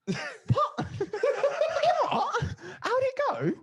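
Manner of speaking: high, incredulous squeal through laughter; laughing in shocked disbelief at the nurse. Retold or imitated. Incredulous retold reaction, laughing.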